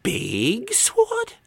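put-on slow, high kid's guess; child. A narrator's reading. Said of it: "said the child".

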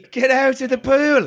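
high slow mock Irish command; Irish accent. Irish wife ordering husband out. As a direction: drag out the Irish scold. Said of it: "an Irish accent".

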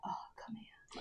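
very soft, whispered come-on; mock seductive. Pretending romance is only sexual, whispered come-on. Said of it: "bring it off as being sexual".

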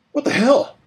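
high, loud shocked yell; startled shout. Acted. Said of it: "Henry shouted".

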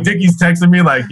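normal talking voice

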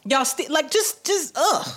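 fed up with copying, get it out; exasperated rant. Real, not acted. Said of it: "I'm so angry".

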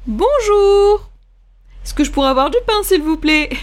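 bonjour poli, lancé bien plus haut; client à la boulangerie. Shown as a demonstration. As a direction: entrer poliment dans une boutique. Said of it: "on va à la boulangerie". (client qui salue la boulangère, voix polie et plus aiguë)

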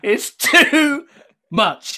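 high, loud, amused echo; laughing repeat. Retold or imitated. Guest relishing the verdict on his accent, laughing. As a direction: repeat the verdict loudly, cracking up.

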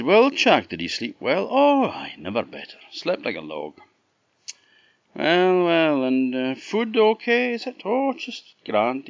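high-pitched, hammed-up pleasantries; hearty acted exchange. Green Man asks Chuck how he slept, hearty. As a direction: play both figures with exaggerated cheer.

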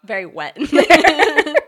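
giggling through the words; laughing. Real, not acted. Laughing mock description.

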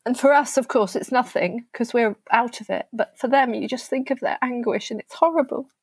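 tearful. Real, not acted. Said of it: "It's quite emotional to recount".

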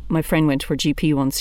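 ordinary talk

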